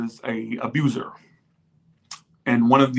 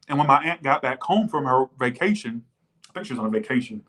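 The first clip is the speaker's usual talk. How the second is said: normal talking voice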